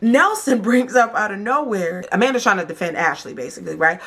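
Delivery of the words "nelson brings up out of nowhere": usual speaking voice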